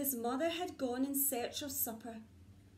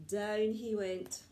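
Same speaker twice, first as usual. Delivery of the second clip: plain reading voice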